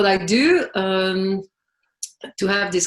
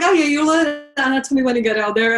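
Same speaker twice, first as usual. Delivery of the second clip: mock breezy reassurance; 8 semitones higher, 6 dB louder, faster than usual. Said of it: "And I was like".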